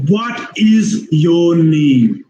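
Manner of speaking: "What is your name" very slow, each word equal; slow, drawn-out word-by-word "What is your name"; full uncontracted form. Shown as a demonstration. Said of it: "Otherwise, it is".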